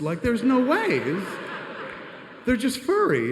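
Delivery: high, loud exasperated whine; exasperated protest. Retold or imitated. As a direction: protest it, incredulous. Exasperated about slippers.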